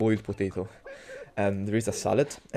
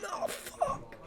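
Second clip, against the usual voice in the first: pained yelp; 9 dB quieter than usual. Real, not acted. Cutting own nail while peeling.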